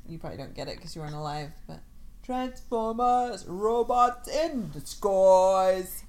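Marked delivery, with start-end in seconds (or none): 2.2-6.0 slow, loud trailer voice; cartoon announcer. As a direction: boom it like an eighties cartoon intro. Quotes eighties cartoon theme.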